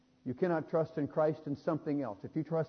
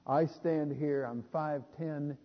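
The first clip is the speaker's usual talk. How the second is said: ordinary delivery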